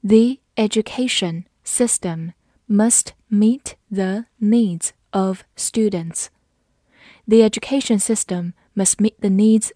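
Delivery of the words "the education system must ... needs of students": slow, careful reading. Shown as a demonstration. Teacher reading the example slowly for students. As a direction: read it slowly, word by word. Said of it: "好，慢读一遍。".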